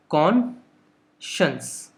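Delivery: कॉन्शंस stretched very slowly; drawn-out pronunciation. Shown as a demonstration. Teacher reads the dictionary respelling aloud, very slowly. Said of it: "देखिए यहाँ पे क्या लिखा है".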